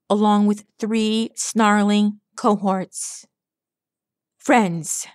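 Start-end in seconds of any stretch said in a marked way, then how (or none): 4.4-5.1 mild shout. A narrator's reading. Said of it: "Astral shouted to the five reunited laborers".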